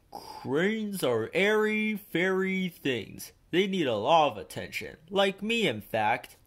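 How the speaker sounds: preen like a vain engine; pompous big engine. A narrator's reading. Gordon lectures Thomas and Percy grandly. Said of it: "said Gordon".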